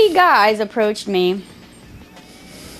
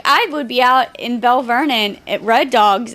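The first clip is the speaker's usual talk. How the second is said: normal talking voice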